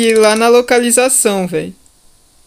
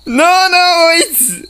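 panicking gamer; 7 semitones higher, slower than usual. Real, not acted. Monster appears, panicked cry.

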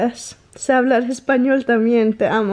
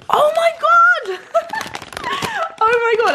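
excited shout. Real, not acted. Finding matcha Kit Kats, she shrieks with joy. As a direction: scream with delight at the gift.

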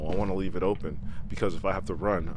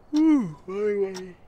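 high, louder startled woo; spooked yelp; 8 dB louder, slower than usual. Real, not acted. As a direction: shake off a scare. Spooked yelp after calling.

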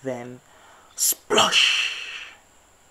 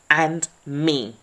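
loud defiant shout; mother badger backing them up; 9 dB louder than usual. A narrator's reading. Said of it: "shouted Mummy Badger".

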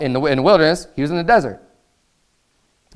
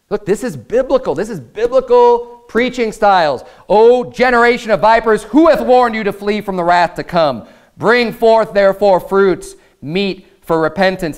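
raised, higher scripture declamation; declaimed preaching; 6 semitones higher than usual. Real, not acted. Preacher quoting John's rebuke of the Pharisees, declaimed preaching. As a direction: proclaim it like a warning to sinners.

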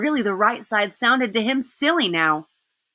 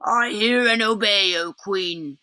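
growling wolf; slower than usual. Acted. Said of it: "growled the wolf".

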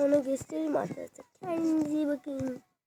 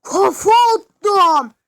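loud, high, slow triumphant shout; drawn-out victory yell; excited yell; 4 semitones higher, 12 dB louder, slower than usual. Real, not acted. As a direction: crow over the headbutt you just landed. Triumphant cry after a headbutt.

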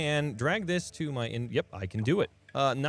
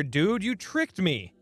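ordinary talk, nothing marked

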